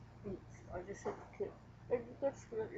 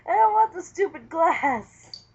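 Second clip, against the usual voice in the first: loud; 7 semitones higher, 20 dB louder, much slower than usual. Real, not acted. Playful grab for partner's glass.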